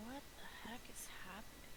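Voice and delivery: quick whispered question. Real, not acted. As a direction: whisper it fast, puzzled. Confused whisper.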